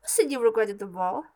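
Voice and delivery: loud, fast scolding question; yelling stepmother. A narrator's reading. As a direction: fire it off as an angry stepmother. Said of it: "yelled the stepmother".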